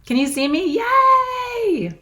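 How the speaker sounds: ordinary talk, nothing marked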